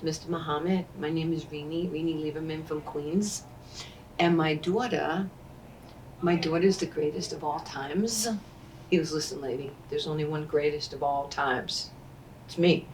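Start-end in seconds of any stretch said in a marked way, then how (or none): 0.0-8.3 imitated proud mother. Mother from Queens introduces her daughter to Muhammad Ali, softly boastful. 9.1-12.9 low, hushed put-down; imitated cool champion. Ali corrects the boastful mother, low and quiet.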